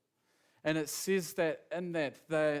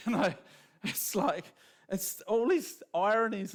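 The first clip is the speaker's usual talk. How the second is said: laughing; 5 semitones higher, 5 dB louder than usual. Real, not acted. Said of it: "you find yourself cracking up".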